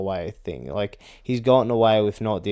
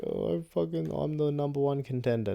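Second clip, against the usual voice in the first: whiny, higher mock of a fighter complaining; mocking Jean Silva; 6 semitones higher, 5 dB quieter than usual. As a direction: sneer it out like a crybaby fighter. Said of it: "have a cry being like".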